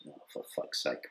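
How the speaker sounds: breathy whispered swear under the breath; whispered curse. Retold or imitated. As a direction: whisper it to yourself in dismay. Inner despair at the police station.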